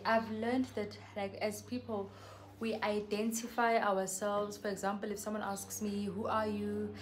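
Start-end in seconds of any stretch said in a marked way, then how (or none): none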